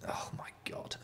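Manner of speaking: breathless whispered disbelief. Real, not acted. Whispering in disbelief at the e-bike's price. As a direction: whisper it under your breath, aghast. Exasperated aside, reacting to e-bike.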